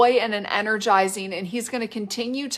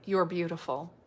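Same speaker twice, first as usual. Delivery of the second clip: soft, low and gently spaced; murmured tenderly, with long pauses between phrases; tender inner voice; 3 semitones lower, 6 dB quieter than usual. Acted. God's quiet voice to a woman at the mirror, soft and tender. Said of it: "it says things like".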